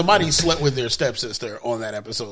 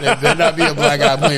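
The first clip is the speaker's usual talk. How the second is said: louder, laughing through the words; 6 dB louder than usual. Shown as a demonstration. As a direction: laugh while saying it. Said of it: "repeats it laughing".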